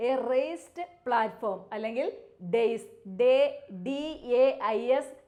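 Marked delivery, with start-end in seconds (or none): 2.6-2.9 ഡേയ്സ് said like days, one clear syllable; pronunciation demo. Shown as a demonstration. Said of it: "ഡേയ്സ് എന്നാണ് pronounce ചെയ്യേണ്ടത്". (ഡേയ്സ് days പോലെ വ്യക്തമായി)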